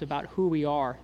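usual speaking voice

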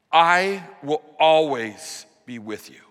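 slow, firm, every word weighted; steady emphatic declaration. Acted. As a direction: press the promise home, I first. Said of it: "It's an emphatic promise".

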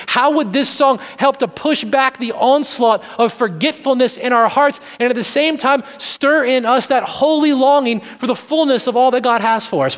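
normal talking voice

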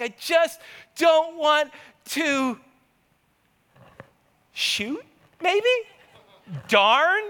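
strained, half-shouted near-curse; mock stifled cursing. Dad sneaking around at dawn, stifling a curse. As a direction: bite back a swear word. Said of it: "and I'm like".

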